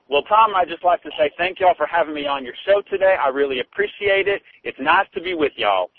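Texas drawl. Shown as a demonstration. [name] showing Tom a real Texas drawl. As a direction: lay on a Southern Texas drawl. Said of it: "I can do a better Texas drawl".

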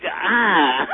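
loud exasperated "ah"; frustrated cry. Real, not acted. Fed-up caller bummed about the news, exasperated cry. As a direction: let out a loud groan of frustration. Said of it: "I could just scream".

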